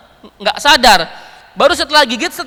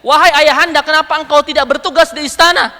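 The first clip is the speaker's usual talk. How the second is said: raised, higher-pitched challenge; complaining; 5 semitones higher, 4 dB louder than usual. Retold or imitated. Child challenges the caliph for not working at the palace, complaining. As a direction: call your father to account, loudly.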